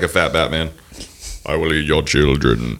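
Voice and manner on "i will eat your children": put-on low child-eating monster voice; Krampus. Host teased about looking like a monster, low creature voice. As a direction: play a low, menacing folklore monster. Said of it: "You look like Krampus".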